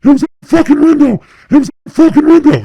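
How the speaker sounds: high, loud, fast panicked yelling; freaking out. Retold or imitated. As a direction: yell it like you just shot at something. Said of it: "Just freaking out".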